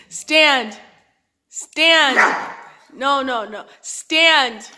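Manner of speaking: loud firm dog command. Real, not acted.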